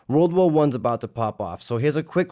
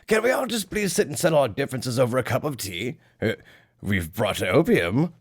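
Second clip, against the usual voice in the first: a fussy British gentleman offering tea; exaggerated upper-class English accent; posh British; 3 semitones higher than usual. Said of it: "Britain immediately is like".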